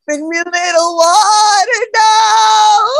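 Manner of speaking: slow high-pitched movie-heroine delivery; dramatic movie quote. Retold or imitated. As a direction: ham it up like a film heroine. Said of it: "She said".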